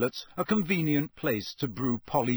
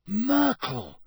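high, outraged exclamation; 9 semitones higher, 3 dB louder than usual. Acted. Harry catches ghost watching his bath, outraged. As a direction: cry it out, scandalised. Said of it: "Harry said in outrage".

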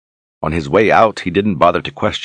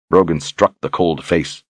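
ordinary delivery, nothing marked

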